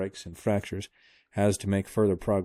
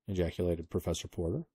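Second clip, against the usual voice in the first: plain reading voice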